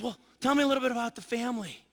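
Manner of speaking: a hint of the chatty attendant; lightly voiced flight attendant. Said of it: "And she says".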